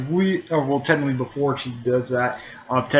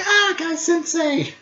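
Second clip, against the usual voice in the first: gushing fan. Retold or imitated. Lee greets his beloved teacher.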